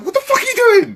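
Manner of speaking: very high, loud incredulous squeal; mock disbelief. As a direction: squeal it in exaggerated outrage, half laughing. Acts out his appalled reaction to singer.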